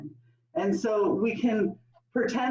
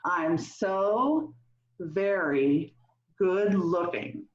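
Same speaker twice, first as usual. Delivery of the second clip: slow, lower, broken into pieces; group affirmation; 4 semitones lower, much slower than usual. Shown as a demonstration. Leader telling everybody here, slow measured affirmation. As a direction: pause between phrases so the group can follow. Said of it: "we're gonna tell everybody here".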